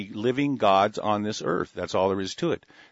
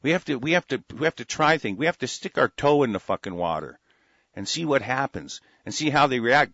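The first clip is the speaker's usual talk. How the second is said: ordinary talk, nothing marked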